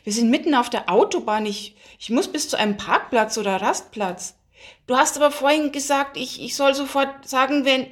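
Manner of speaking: fast, high-pitched flustered protest; panicked. A narrator's reading. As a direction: rush it out in rising alarm. Driver panics at friend's demand. (panisch und hektisch)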